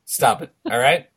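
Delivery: usual speaking voice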